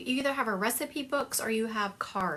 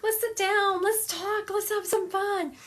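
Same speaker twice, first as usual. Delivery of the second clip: high, louder playful invitation; very fun lady; 12 semitones higher, 4 dB louder, slower than usual. Retold or imitated. Spirit lady inviting company to sit and talk, playful. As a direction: imitate her eagerly rounding everyone up for fun. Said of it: "this lady was very fun".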